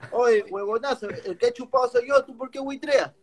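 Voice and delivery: mock irate father, pitch climbing; scolding father. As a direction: scold your son in disbelief. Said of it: "mi viejo agarra y me dice".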